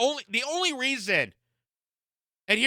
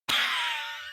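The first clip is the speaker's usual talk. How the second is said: laugh; 5 dB quieter than usual. Real, not acted. Reacting to absurd claim.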